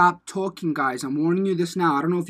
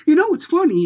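high, loud chiming in; eager butting in; 11 semitones higher, 7 dB louder than usual. Retold or imitated. Butting into strangers' chat at a bar, loud high eagerness. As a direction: jump brightly into someone else's chat.

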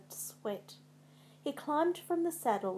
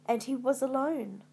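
usual speaking voice

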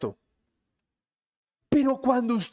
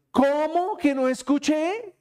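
demanding, mock indignant; 7 semitones higher, slower than usual. Demanding louder apology.

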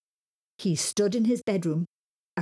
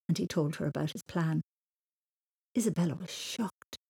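plain reading voice

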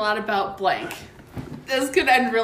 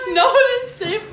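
laughing; 10 semitones higher, 5 dB louder, faster than usual. Real, not acted. Repeats line still laughing.

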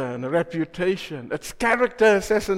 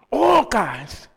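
All kinds punched higher and firmer; stress on all kinds; 4 semitones higher, 4 dB louder than usual. Shown as a demonstration. Preacher driving home the correction, emphatic. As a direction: hit All kinds hard. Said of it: "That's the correct".

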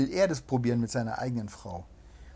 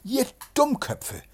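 calling out; 11 semitones higher, 3 dB louder, slower than usual. A narrator's reading. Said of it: "riefen die Weiber".

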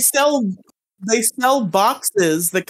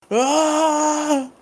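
battle scream; 12 semitones higher than usual. Pretend. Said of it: "and he's like".

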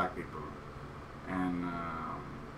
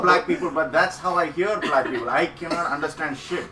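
raised, higher-pitched insistence; loud mock protest; 6 semitones higher, 9 dB louder than usual. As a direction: protest loudly as if defending yourself on stage. Retold loud protest on stage.

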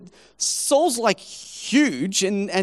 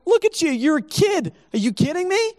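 Saul's disbelief; 7 semitones higher than usual. Retold or imitated. Saul scoffs at young David.

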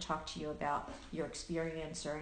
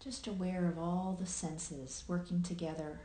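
unhurried meditation guiding with long pauses; guided meditation; 4 dB quieter than usual. Acted. Host guiding listeners into present awareness, calm meditation voice with long pauses. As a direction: lead listeners gently through their senses, leaving long silences.

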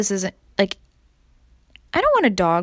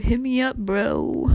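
laid-back cool dude; slower than usual. Pretend. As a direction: say it like a chill bro. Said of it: "Sound like cool dude".

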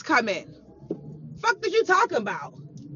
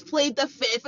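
ordinary talk, nothing marked

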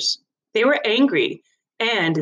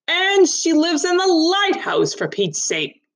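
exasperated villager; 11 semitones higher than usual. Acted. Briggs accusing in court.

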